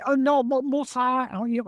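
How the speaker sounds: high, loud eager driver correcting him; excited driver. Retold or imitated. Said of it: "He's going".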